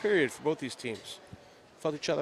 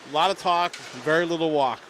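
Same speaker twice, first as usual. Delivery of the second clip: loud; 2 semitones higher, 7 dB louder than usual. Real, not acted. Quip about chirping.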